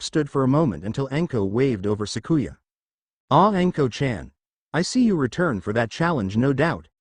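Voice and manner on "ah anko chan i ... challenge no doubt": plain reading voice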